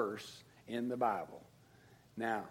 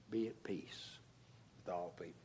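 hushed; 6 semitones lower, 14 dB quieter, faster than usual. Acted. Soft close of quoted verse.

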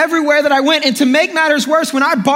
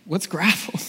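put-on quiet kid voice; 5 semitones lower, 10 dB quieter than usual. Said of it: "They're gonna be like".

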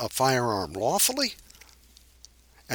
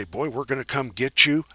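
ordinary talk, nothing marked

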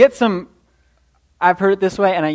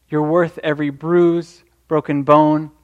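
ordinary delivery

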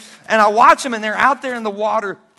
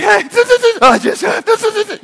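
put-on sniffling child; 11 semitones higher, 6 dB louder, much slower than usual. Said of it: "You're like".

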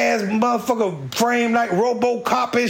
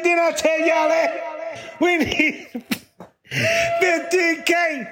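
angry boss yell; 10 semitones higher than usual. Retold or imitated. District manager yelling at the crew for closing the sheds. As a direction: shout it like a furious boss catching them slacking.

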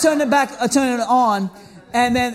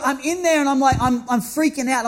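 usual speaking voice

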